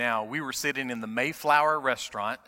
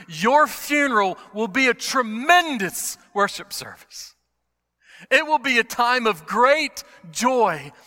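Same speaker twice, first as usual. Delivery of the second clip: raised, forceful pulpit voice with slow, weighted phrases; impassioned preaching; 8 semitones higher, 7 dB louder than usual. Real, not acted. Preacher on the true measure of a person, impassioned preaching. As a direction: preach it high and strong, leaning on the key words.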